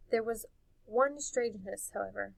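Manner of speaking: ordinary delivery, nothing marked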